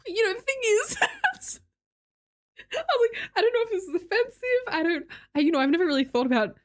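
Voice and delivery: high, giggly, flustered rambling; flustered laughing. Retold or imitated. Telling a religious friend about her Pope cosplay, laughing nervously. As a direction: stammer it out through nervous laughter.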